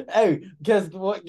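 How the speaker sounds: ordinary delivery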